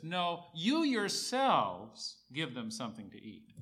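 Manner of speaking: heavy, slow weight on "you yourselves"; stress on YOU YOURSELVES. Acted. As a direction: punch "you yourselves" slowly, then ease off. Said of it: "The emphasis is on them".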